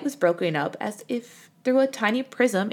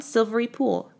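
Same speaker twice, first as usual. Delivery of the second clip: usual speaking voice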